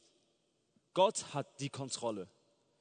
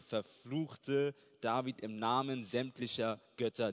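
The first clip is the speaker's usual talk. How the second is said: normal talking voice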